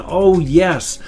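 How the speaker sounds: usual speaking voice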